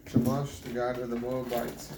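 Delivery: ordinary delivery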